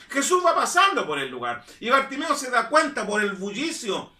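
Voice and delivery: ordinary talk, nothing marked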